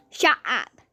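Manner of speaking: bratty loud dismissal; loud sassy retort. Retold or imitated. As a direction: snap it at him. Telling the cashier off.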